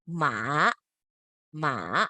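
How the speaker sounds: MA dipped low, then up a bit; dipping third tone. Shown as a demonstration. Teacher modelling the third tone, down then up. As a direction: scoop MA down and back up. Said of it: "go downwards and then you go upwards".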